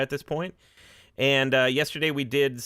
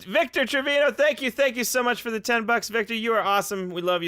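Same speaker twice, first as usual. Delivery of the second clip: excited thanks; 8 semitones higher than usual. Real, not acted.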